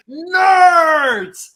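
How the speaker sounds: one high, loud, drawn-out yelled word; mocking shout. Guy squatting down yelling to his friend, loud high mock shout. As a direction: crouch down and bellow it for emphasis. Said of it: "he's like".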